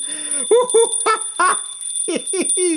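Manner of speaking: high, tittering giggle; wife's giggle. A narrator's reading. Fireman's wife tickled, high tittering giggle. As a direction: giggle girlishly. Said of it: "Said his wife".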